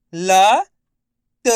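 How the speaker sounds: लत stretched out loud, letter by letter; drawn-out syllables. Shown as a demonstration. Teacher sounds out लत slowly for pupils to repeat. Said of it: "मैं किस तरीके से एक एक अक्षर का उच्चारण".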